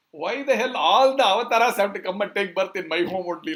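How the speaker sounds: acted indignant outburst; exasperated complaint. Yashoda fed up with avatars in her home, loud exasperation.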